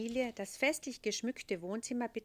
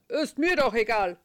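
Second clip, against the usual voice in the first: loud gruff terrier; barking dog voice; 3 semitones higher, 12 dB louder than usual. A narrator's reading. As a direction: bark the words like a dog. Dog character.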